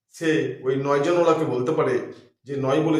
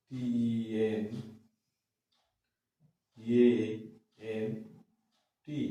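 spelling letter by letter; 4 semitones lower, 6 dB quieter, slower than usual. Shown as a demonstration. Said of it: "এটা হচ্ছে spelling".

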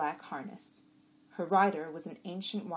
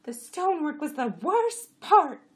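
high, loud, slow donkey voice; complaining jenny; 11 semitones higher, 12 dB louder than usual. A narrator's reading. Jenny grumbling about the road, high and loud. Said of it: "Chamra said".

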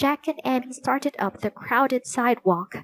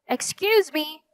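higher, more insistent call; louder voice; 7 semitones higher than usual. A narrator's reading. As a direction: raise your voice a little to get attention. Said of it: "in a louder voice".

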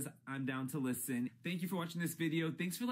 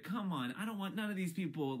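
ordinary talk

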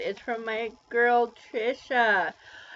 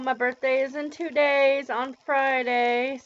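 usual speaking voice

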